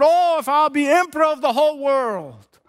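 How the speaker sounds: high, sustained cry of craving; imitated crying out. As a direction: act out a grand, high-pitched wish. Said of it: "he cried out and said".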